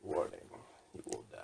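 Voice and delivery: very low, soft announcer warning; movie trailer warning. Acted. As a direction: sink into an ominous trailer murmur. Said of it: "it's like a commercial".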